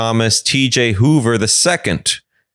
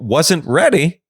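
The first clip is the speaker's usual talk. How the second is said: ordinary talk, nothing marked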